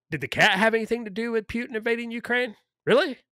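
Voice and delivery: incredulous. Acted. Mocking ban on Russian cats.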